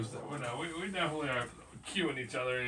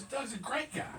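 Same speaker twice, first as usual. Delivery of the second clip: put-on higher, earnest kid voice; imitating his son; 11 semitones higher than usual. Father quoting his son's praise of Doug, higher. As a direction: lightly play the sincere son. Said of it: "He goes".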